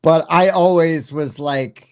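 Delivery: ordinary delivery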